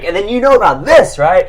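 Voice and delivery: loud, high teasing exclamation; mock know-it-all. Friend teasing his fact-dropping pal, loud mock lecture.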